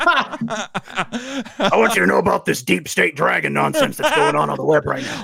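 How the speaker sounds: a mock conspiracy host leaning in; Alex Jones impression. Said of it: "sounds like less conspiratorial Alex Jones".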